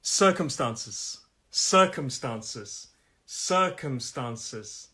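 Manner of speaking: "Circumstances" drawn out syllable by syllable; slow model. Shown as a demonstration. Teacher introducing the next word, slow careful model. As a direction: stretch "Circumstances" out slowly for learners.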